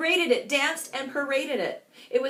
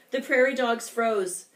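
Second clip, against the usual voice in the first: plain reading voice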